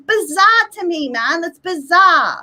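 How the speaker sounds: usual speaking voice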